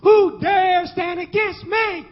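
high, loud, drawn-out preacher's cry; defiant shout. Real, not acted. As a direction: shout the challenge, stretching every word. Climax of the sermon's challenge.

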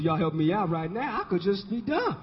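ordinary talk

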